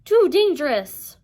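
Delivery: slow, high, loud alarm; yelling. Acted. Sisters warning Percy, alarmed yelling. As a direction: yell a drawn-out warning. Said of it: "the Grey sisters yelled".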